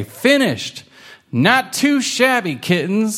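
high, proud and pleased; proud grandma voice. Acted. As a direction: praise the kittens like a beaming grandma. Grandma praises the kittens' rocket.

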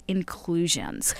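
inclusions drawn out slowly and softly; wry emphasis on inclusions. Shown as a demonstration. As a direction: say inclusions slowly and quietly, as if in quotes. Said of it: "here we go with the word".

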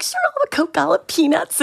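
bright and cheeky, like nothing's wrong; chirpy kid. Retold or imitated. Stowaway girls ordering snacks from the stunned stewardess, chirpy.

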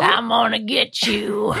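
mock-menacing creepy villain voice; knuckle-dragging bad guy. Mocking the obvious cartoon villain, creepy monster voice. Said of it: "dragging their knuckles with rotten teeth".

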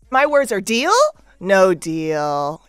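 DEAL lifted like a question, pause, NO DEAL dropping down; game-show delivery. Shown as a demonstration. Guest giving three words like the game show, suspenseful. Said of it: "You have to kinda say it like that".

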